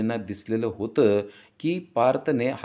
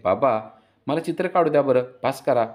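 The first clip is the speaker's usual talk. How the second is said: louder, higher daughter's voice; little daughter; 5 semitones higher, 7 dB louder than usual. A narrator's reading. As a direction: plead with dad as his daughter, loudly. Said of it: "पिहू म्हणते".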